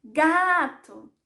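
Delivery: "Gato" called loud with a long stressed GA; drawn-out loud "Gato", falling on "to"; calling out. Shown as a demonstration. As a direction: call "Gato" slowly, stretching the first syllable. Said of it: "Mas eu vou chamar".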